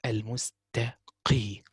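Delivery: "Al-mustaqi" drawn out slowly with a heavy qaf; slow correct pronunciation. Shown as a demonstration. Teacher modelling "Al-mustaqi" slowly for students. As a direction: spell out "Al-mustaqi" slowly, each letter clear. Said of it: "So you should say al-mustaqi".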